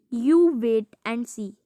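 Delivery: very slow, word by word; slow reading. Shown as a demonstration. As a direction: spell it out for the kids. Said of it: "slow reading aloud".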